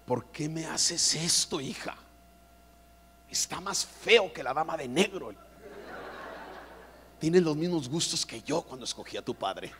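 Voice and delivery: a hint of a disappointed mom; lightly voiced weary mother. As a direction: sigh at the daughter's taste. Mother complaining to daughter.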